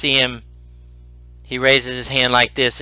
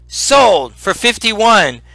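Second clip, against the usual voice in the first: slow, higher-pitched closing call; auctioneer's "sold"; 6 semitones higher, much slower than usual. Acted. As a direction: declare the sale to the crowd. Auctioneer closes the bidding.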